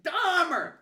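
loud mock yell of a name; retold yelling. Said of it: "he starts yelling at me".

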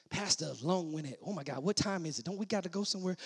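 low, very soft muttered complaint; hushed mock impatience; grumbling churchgoer. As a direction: mimic a bored member grumbling under the breath.

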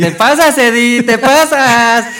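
high, loud, slow mocking squeal; squeaky teasing voice. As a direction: stretch it out in a silly high voice. Playful put-on teasing voice. (voz chillona de burla)

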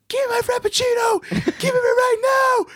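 shrieking high demand for coffee, rude and shrill-pitched; high shouting rant. Retold or imitated. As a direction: yell it at the cashier, high and furious. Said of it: "yelling at the cashier".

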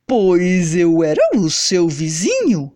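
low, lightly voiced hunter. A narrator's reading. Hunter speaks.

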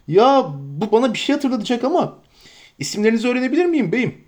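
a flustered, fussing landlord; bustling innkeeper. Acted. Innkeeper Butterbur welcoming the hobbits, eager and fussy.

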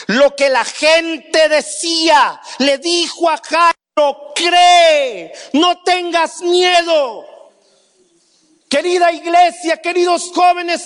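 high, loud, slow impassioned appeal; full-force preaching. Acted. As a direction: plead with the church at full voice. (predicación apasionada a todo pulmón)

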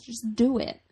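a light mock motivational push; half-hearted pep-talk bark, just a touch put on; Shia LaBeouf. Host jokingly urging listeners, lightly mock motivational. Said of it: "I'm Shia LaBeoufing you guys".

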